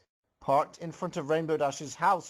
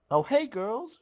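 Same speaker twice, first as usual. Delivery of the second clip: called out high and bright to friends; yelled greeting; 9 semitones higher, 4 dB louder than usual. Acted. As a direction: shout a cheery hello across the yard. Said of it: "she yelled out to them".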